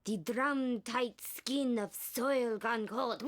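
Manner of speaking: slow hammy witch reading into loud cackle; wicked witch. Acted. Actor overdoing the director's note, wicked witch. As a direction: draw it out, then burst out cackling. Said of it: "Wicked Witch of the West?".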